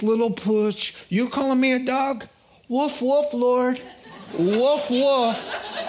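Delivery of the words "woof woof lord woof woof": playful barking. Acted. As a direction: bark like a happy little pooch. Woman playing the dog, barking.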